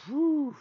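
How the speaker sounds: sharp impressed whew, pitched high; loud whew. Real, not acted. Reacting to the main event.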